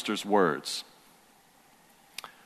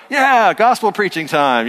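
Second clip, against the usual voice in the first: high, loud mock cheer; imitated excited kids; 9 semitones higher, 7 dB louder than usual. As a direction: cheer like kids let loose to play. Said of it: "And they're like".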